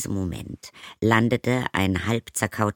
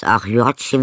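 louder, hammed-up fake grief; mock lament; 3 semitones higher, 9 dB louder than usual. Stepmother fakes sorrow to Zoe, louder and hammy. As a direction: put on a showy sigh of pity.

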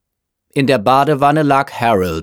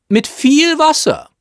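high, raised-voice afterthought; calling after someone; 5 dB louder than usual. A narrator's reading. Mother calling more advice after her son, shouted. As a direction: add it in a high, carrying call. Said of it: "rief ihn Misses Chasen hinterher".

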